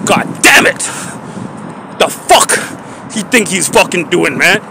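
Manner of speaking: soft, mostly whispered grumbling; muttered under breath. Real, not acted. After the guard leaves, quiet muttering. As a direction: mutter it to yourself, still annoyed.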